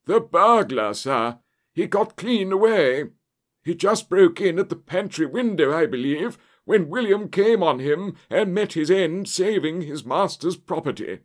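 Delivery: high, loud, agitated butler; panicked servant voice, pitched right up; breathless butler gasp. A narrator's reading. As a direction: burst out with shocking news. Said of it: "he gasped".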